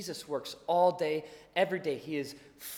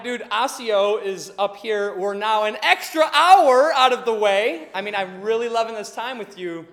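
mock exasperation; 4 semitones higher, 11 dB louder than usual. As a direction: drag it out, fed up but joking. Exasperated at friend driving wrong way.

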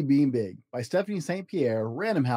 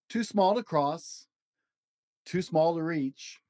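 slow, lilting picture-book read with long page-turn pauses; reading to children; 4 semitones higher, slower than usual. Acted. Dad reading a picture book to his child, slow storybook lilt. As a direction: read it like a bedtime storybook for a little one.

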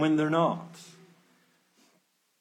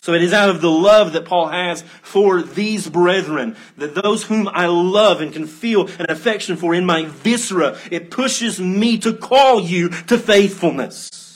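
raised, loud, insistent preacher delivery; fervent preaching; 4 semitones higher, 7 dB louder than usual. Real, not acted. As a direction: drive it home to the congregation. Preaches at full force.

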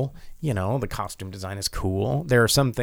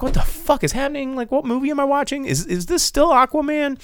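high incredulous. Retold or imitated. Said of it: "and, like".

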